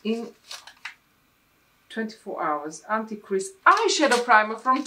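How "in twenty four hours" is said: ordinary talk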